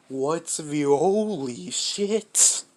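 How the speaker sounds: slow, loud startled outburst; alarmed shout. Retold or imitated. Bakugo's dad runs in at the monster, slow loud startled yell. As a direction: bellow it as you burst in.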